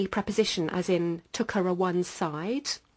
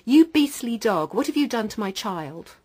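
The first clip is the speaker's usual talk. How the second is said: angry mother; 4 semitones higher, 6 dB louder than usual. Retold or imitated. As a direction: hurl it at him in outrage. Mother confronts the accused.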